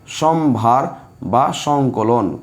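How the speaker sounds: very slow, word by word; drawn-out words with spaces between; slow dictation. Shown as a demonstration. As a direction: dictate it slowly for pupils writing it down. Dictating a meaning to the class.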